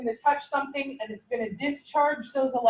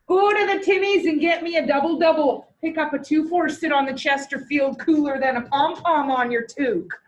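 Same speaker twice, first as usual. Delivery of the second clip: mock Canadian accent; hammed-up Canadian; 2 semitones higher than usual. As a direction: play up the Canadian accent for laughs. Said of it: "teaching you guys a little bit of Canadian".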